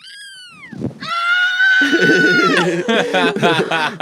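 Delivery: high, squeezed-throat kitten meow. Shown as a demonstration. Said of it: "I can meow like a kitten".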